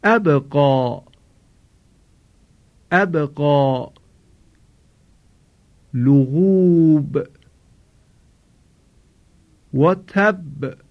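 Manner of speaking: slow tajwid, the ب of لغوب released with a bounce; recitation with qalqala. Shown as a demonstration. Teacher models the ba examples for the class. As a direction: chant slowly, echoing each ba. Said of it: "مثال برای حرف با".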